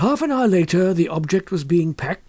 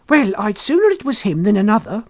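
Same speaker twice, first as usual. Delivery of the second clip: a lightly voiced shopkeeper's wife, relieved; 6 semitones higher, 4 dB louder than usual. A narrator's reading.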